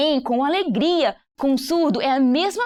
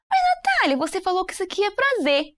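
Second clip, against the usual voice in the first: high voice of a puzzled student; objecting student; 8 semitones higher than usual. Retold or imitated. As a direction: voice the viewer's objection. (aluno confuso com voz aguda)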